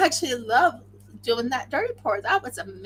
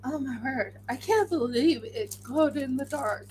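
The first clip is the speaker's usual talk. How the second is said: usual speaking voice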